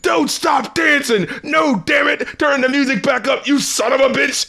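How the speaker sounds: high-pitched mock yelling; imitated shouting. Imitating someone in a clip before an explosion, mock shouting. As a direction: holler like an outraged bit player, half-voiced. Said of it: "here's someone going like".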